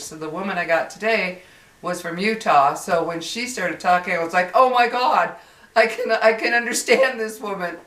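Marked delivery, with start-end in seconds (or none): 4.5-7.8 louder, higher burst of relief; relieved. Retold or imitated. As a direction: exclaim it, amazed to finally understand someone. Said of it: "it was like a relief".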